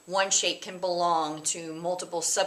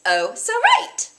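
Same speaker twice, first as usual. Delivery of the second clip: put-on high, very slow valley girl; Valley Girl accent, much higher and louder; 9 dB louder, much slower than usual. Shown as a demonstration. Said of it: "with that little bit of an accent there".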